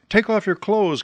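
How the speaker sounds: a wild goose calling down from the sky. Acted. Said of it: "came a wild voice from the sky".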